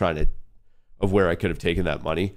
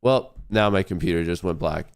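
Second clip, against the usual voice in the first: ordinary talk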